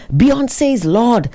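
high, slow proclaiming, imitating her; retold rambling. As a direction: mimic her raving like a slow chant. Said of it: "she started rambling".

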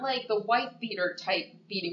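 normal talking voice

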